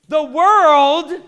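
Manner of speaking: loud, high comic rant; incredulous shout to the crowd; exasperated outrage. Real, not acted. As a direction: belt it out in disbelief, leaning hard on the first words. Comic outrage at wife being let go.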